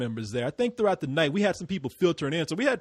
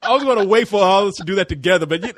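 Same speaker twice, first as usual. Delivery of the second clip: normal talking voice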